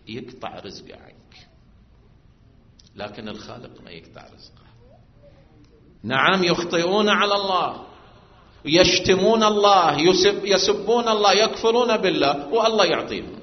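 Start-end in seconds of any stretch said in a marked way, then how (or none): 6.0-13.3 raised, louder preacher's voice; preaching at full force. Acted. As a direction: drive the point home to the congregation. Preacher lists sins, rising.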